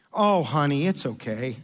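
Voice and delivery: low, soothing Southern comfort; imitating a motherly Southern consoling; bless-your-heart sympathy. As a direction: soften and drop into pitying reassurance. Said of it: "the bless your heart".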